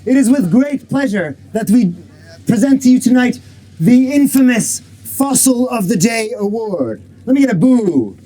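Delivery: booming ring announcer; big showman intro voice; lucha libre announcer. Acted. Announcer opening an awards show.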